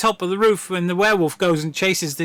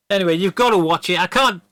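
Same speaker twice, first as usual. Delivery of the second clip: ordinary delivery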